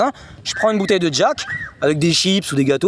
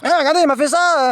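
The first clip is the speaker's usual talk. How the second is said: high-pitched acted complaint; re-enacted high, outraged telltale; tattling security guard; 9 semitones higher than usual. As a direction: tattle to the police in a raised voice.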